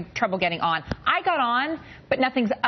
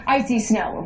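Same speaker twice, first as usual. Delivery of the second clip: usual speaking voice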